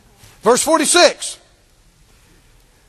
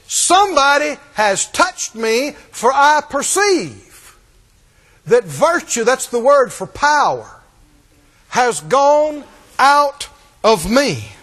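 slow emphatic reading; slower than usual. Acted. Preacher reads Jesus slowly.

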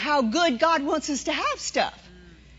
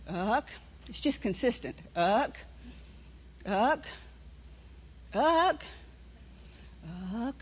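a soft, lower uck, uck, barely there; the warning as a quiet, steady murmur; real low; 4 semitones lower, 12 dB quieter, slower than usual. Shown as a demonstration. Preacher shows the congregation the quiet inner nudge, soft and low. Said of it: "or it may even be real low".

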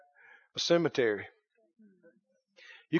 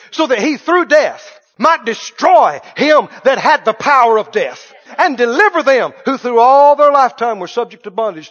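higher, loud preacher's cadence; fiery preaching; 4 semitones higher, 9 dB louder than usual. Acted. Preacher telling the congregation life is a vapor, at full force. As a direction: preach it with fire.

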